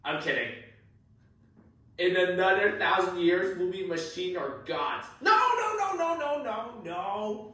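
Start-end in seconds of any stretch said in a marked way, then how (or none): 5.2-7.5 rejecting own silly line in a panic; frantic no. Real, not acted. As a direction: squeal of embarrassed protest.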